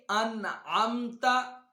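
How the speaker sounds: अन अमता with a clear throaty ain, slow and stretched; correct ain sound. Shown as a demonstration. Teacher shows the right ain after two wrong forms. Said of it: "बल्कि".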